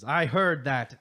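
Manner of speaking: put-on gruff old voice, loud. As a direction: growl it out gruffly. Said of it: "gruff voice".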